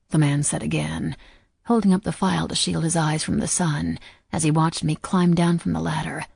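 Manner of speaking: plain reading voice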